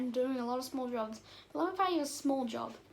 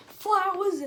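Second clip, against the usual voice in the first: FLOWERS cried out high and loud; loud exclamation; 5 semitones higher, 7 dB louder than usual. Acted. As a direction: burst out with a louder, higher exclamation. Outburst at the word flowers.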